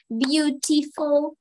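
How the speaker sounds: BEAUTIFUL slowly split into its syllables; measured three-beat "Beautiful" for learners. Shown as a demonstration. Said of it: "three syllables".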